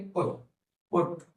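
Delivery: short, clipped vowel in "पुट"; "पुट" as a teacher's short-vowel demo. Shown as a demonstration. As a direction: say "पुट" with a brief "u". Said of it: "छोटा ऊ".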